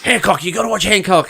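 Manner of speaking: high-pitched eager pestering; imitating an insistent fan; mock urging. Retells someone urging them to watch.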